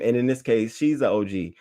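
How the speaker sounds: normal talking voice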